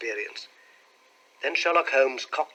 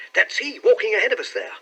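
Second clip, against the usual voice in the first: high, louder pointing-out; excited cry; 8 semitones higher, 6 dB louder, faster than usual. A narrator's reading. Clerk showing companions the man ahead, excited. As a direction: point him out with raised, eager voice. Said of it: "cried the clerk".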